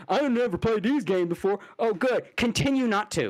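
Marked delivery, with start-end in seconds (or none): 0.1-1.6 mocking quote. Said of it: "Go away with your".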